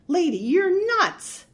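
high, slow alarmed burglar; alarmed exclamation. A narrator's reading. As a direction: yell it in disbelief.

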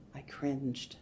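plain reading voice

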